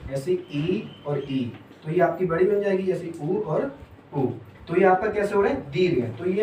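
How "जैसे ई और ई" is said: ई और ई stretched slowly and softly; drawn-out vowel demo. Shown as a demonstration. Teacher showing students how ई and ई join, drawn out. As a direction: draw out each ई to show a long vowel. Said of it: "दीर्घ का मतलब होता है बड़ा".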